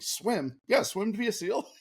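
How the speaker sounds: high-pitched mock incredulity; incredulous, voice climbing high; retold disbelief. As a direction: replay being baffled, pitch jumping up. Retelling his disbelief at swimming.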